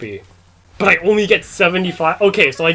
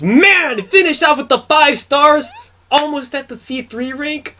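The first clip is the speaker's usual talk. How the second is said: excited; 9 semitones higher, slower than usual. Real, not acted. Happy wrap-up.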